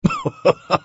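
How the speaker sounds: a high burst of laughter; hearty laugh. Acted. Velchaninov laughing heartily at the drunk youth. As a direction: laugh from the belly. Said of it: "از ته دل خندید".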